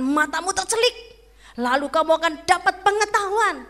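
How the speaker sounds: tempting devil. Acted. The devil loudly coaxes Eve to eat the fruit, tempting. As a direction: sell the lie to Eve, big and sweet.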